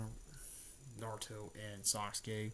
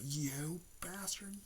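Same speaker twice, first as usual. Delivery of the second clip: furious whisper; 10 semitones higher than usual. Retold or imitated. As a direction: hiss the accusation without voice. Naruto furious at the enemy.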